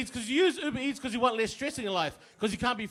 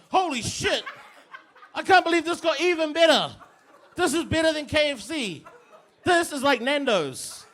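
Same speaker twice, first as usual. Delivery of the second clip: ecstatic amazement; 6 semitones higher than usual. Retold or imitated. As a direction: get carried away with delight. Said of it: "you're just like".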